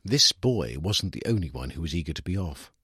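usual speaking voice

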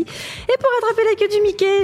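fairground barker voice. Shown as a demonstration. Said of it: "j'adore faire les voix de fête foraine".

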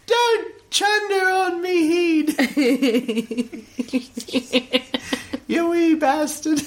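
slow laughing mock-Brit complaint; hammed-up British protest; mock British accent. Quoting himself to a co-host in mock British. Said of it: "And I was like".

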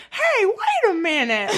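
mock-indignant, high, loud and slow, laughing through it; indignant listener. Imitating a listener who objects to the piece, mock-indignant and laughing. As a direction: play an outraged listener with a laugh. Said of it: "people be like".

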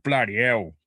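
bloody hell in a London accent. Shown as a demonstration. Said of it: "has to be pronounced in the London accent".

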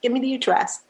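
high, eager retold rush of agreement; excited. Singer accepting [name]'s offer to visit, excited. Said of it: "I was so excited".

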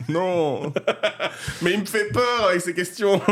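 laughing high through the words. Real, not acted. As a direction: giggle out the complaint. Amused by interviewer's odd question.

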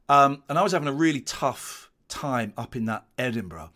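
ordinary talk, nothing marked

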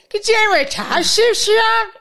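high and loud, calling on a pupil; teacher on tape. Retold or imitated. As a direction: mimic the exam tape examiner, high and loud. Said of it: "And what does he say?".

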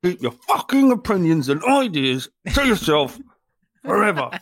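lightly hammed-up rough bloke talking down to Todd; mock James. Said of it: "Just the image of James".

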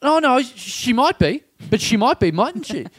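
high, insistent questioner; crazy girl. Retold or imitated. Crazy girl pressing her theory on the woman, high insistent voice. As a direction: imitate a keen weirdo, pitched well up. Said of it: "the crazy girl goes".

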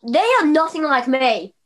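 high-pitched pretend Scotsman; mock Scottish accent. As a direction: put on a defiant fake Scot. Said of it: "They were sitting there thinking".

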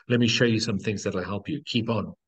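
normal talking voice

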